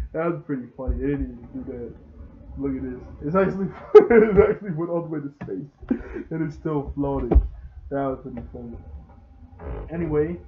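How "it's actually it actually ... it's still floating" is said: louder, laughing mid-sentence; can't hold back the laugh. Real, not acted. Amused by the floating scene.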